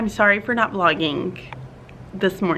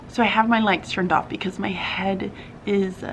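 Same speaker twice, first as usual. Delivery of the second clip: whispering; 6 dB quieter than usual. Real, not acted. Headache, lights off.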